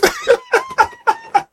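very high-pitched, real cackle; cackling laugh. Real, not acted. Host cracking up at their own bad Trini accent, cackling. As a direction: burst into long, squealing laughter.

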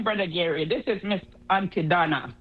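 normal talking voice

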